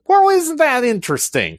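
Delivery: put-on amazed Southern TV host accent. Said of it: "he had some Southern accent".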